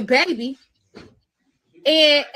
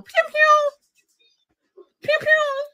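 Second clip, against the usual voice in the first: pew pew; 12 semitones higher, much slower than usual. Pretend. Mock gunshot noises.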